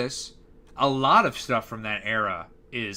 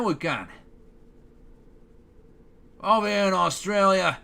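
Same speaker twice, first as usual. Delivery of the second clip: loud, higher-pitched hammed-up Australian accent; mock Australian accent; 4 semitones higher, 4 dB louder than usual. Shown as a demonstration. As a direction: do a broad amateur Aussie impression. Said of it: "Could I do an Australian accent?".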